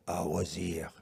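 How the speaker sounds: put-on low French-accented English; weird French accent. Repeating the friend's words for the audience, in a weird French accent. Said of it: "I said it in a weird French accent".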